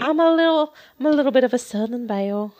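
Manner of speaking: mild hammed-up Southern belle accent; put-on Southern belle accent. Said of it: "adding an accent on top of my accent".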